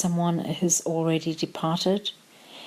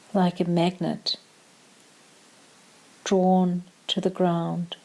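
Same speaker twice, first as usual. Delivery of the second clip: calm meditation voice with long pauses; short, measured phrases, leaving room to breathe; guided meditation; slower than usual. Acted. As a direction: guide them gently into stillness, unhurried.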